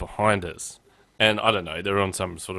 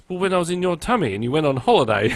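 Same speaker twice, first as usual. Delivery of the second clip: higher, louder retold little boy; explaining child; 7 semitones higher, 5 dB louder than usual. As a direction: explain it like a confident kid. Retelling son's cheeky explanation.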